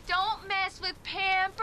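slow, drawn-out parody of a boast; mocking taunt. [name] turning Jen's boast back on Jen, slow mocking taunt. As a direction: stretch Pampers to needle them.

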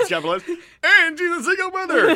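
loud, high, slow showman reveal; mock grand announcement. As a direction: announce it like a game show host. Pictures someone holding a card.

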